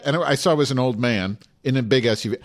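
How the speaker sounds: normal talking voice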